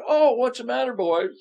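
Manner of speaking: put-on high, teasing jeer at the boys; sarcastic high taunt; mocking taunt. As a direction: needle them with a mock-sweet question. Elijah mocking the Baal prophets.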